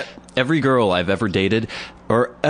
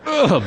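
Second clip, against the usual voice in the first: straining grunt; 14 semitones higher, 4 dB louder than usual. Retold or imitated. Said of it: "I'm like".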